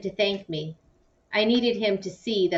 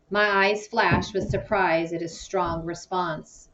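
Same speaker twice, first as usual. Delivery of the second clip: usual speaking voice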